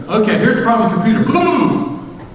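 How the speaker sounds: high, fast geek patter turning to gibberish; too-fast talking. Retold or imitated. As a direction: race through it like an unstoppable technician. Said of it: "You're speaking so fast".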